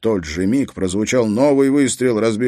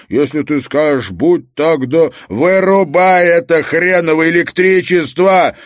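loud, high, furious bark; barked order; 8 semitones higher, 8 dB louder than usual. A narrator's reading. Harry demanding Treska cut the power now, barking. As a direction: build to a full-voiced shout. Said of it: "рявкнул Харри".

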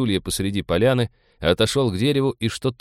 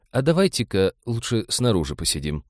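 plain reading voice

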